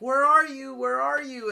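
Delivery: high, loud desperate yell; yelling for him. Retold or imitated. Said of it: "yelling for him".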